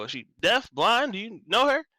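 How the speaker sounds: high, loud rising questions; incredulous questioner. Acted. Game master's character asks Malik about her, incredulous. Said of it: "he's gonna look at Malik and go".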